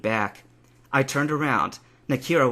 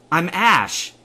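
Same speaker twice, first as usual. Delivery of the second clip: raised, loud correction; yelling; 6 semitones higher, 6 dB louder than usual. A narrator's reading. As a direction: insist on the name loudly. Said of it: "Would you stop yelling?".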